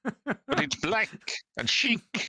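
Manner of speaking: very high, slow, laughing mock Italian accent. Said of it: "the Italians' look of horror".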